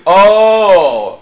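long, loud mock-disappointed "Oh"; guilt-tripping. Shown as a demonstration. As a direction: wail out the guilt trip. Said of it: "my old style".

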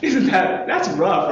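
ordinary talk, nothing marked

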